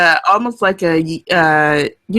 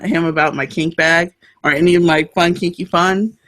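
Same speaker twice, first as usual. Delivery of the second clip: ordinary talk, nothing marked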